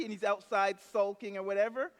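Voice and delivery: normal talking voice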